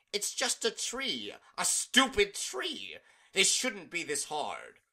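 high, loud and slow with frustration; frustrated. Acted. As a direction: whine it out in furious frustration. Discord frustrated over failed tree.

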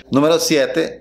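ordinary talk

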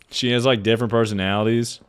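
usual speaking voice

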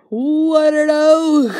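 loud high crying out; meme shout. Pretend. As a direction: yell it like the meme.

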